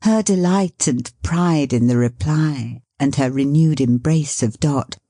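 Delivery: plain reading voice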